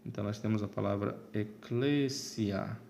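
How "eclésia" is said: "eclésia" with stress on CLÉ; tônica na antepenúltima. Shown as a demonstration. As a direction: lean on the second syllable: eCLÉsia. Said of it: "a tônica será a antepenúltima". ("eclésia" com ênfase no CLÉ)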